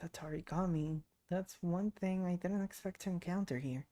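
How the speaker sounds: ordinary talk, nothing marked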